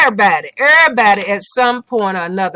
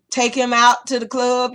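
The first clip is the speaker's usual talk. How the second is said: ordinary talk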